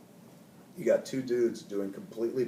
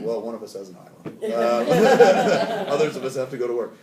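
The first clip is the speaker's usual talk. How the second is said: real laughter, much louder, breaking through the joke; laughing at his own joke to the star; 2 semitones higher, 13 dB louder than usual. Real, not acted. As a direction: laugh your way through the punchline. Joking reply, laughing through it.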